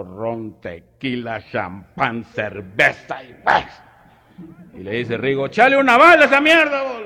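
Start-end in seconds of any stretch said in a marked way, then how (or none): 5.5-7.0 very high, loud rancher holler; rough shout. Retold or imitated. Rigo telling the bartender to add a bullet, very high and loud. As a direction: bark the order like a tough cowboy.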